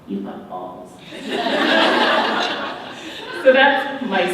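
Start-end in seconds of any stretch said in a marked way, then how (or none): 0.0-0.9 low, hushed mock man's voice; gruff guy. Imitating the guy who punched her shoulder, low and hushed. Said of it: "and he goes". 1.0-3.4 real laugh, loud and breathy. Real, not acted. Speaker laughing after the guy's quip, real laugh. Laughing at her own punchline.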